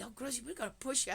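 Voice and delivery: put-on high, fast eager little girl; granddaughter's eager voice. Granddaughter promising to push grandma's wheelchair, high and fast. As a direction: chirp it like an excited grandchild. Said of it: "she's already".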